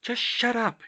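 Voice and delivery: high-pitched self-scolding; flustered self-reproach; chiding herself. A narrator's reading. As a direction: scold yourself in embarrassment. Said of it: "she chided herself".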